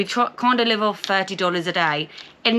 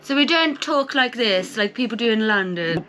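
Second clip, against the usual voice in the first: posh London accent; 3 semitones higher than usual. Shown as a demonstration. Showing viewers how they don't talk, posh London accent. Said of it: "rich people speak like that".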